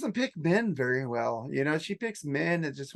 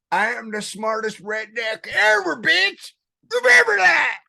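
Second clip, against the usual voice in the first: rising into a high, loud yelled boast; 6 semitones higher, 7 dB louder than usual. Acted. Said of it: "do the podcast in that voice".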